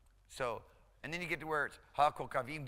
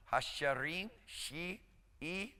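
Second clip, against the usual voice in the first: ordinary delivery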